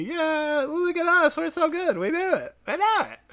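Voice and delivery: very high falsetto cheering; hammed-up squeaky nerd glee; mock fan celebration. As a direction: squeal like self-satisfied fans. Mimicking celebrating comic book readers.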